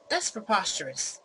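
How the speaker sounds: loud, affronted protest; indignant denial. Acted. Fake Mike loudly protesting Sarah's accusation, affronted.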